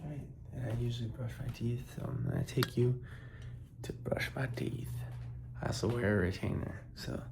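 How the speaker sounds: hushed, soft-spoken morning talk; quiet. Real, not acted. I gotta be a little quiet 'cause Sean is sleeping.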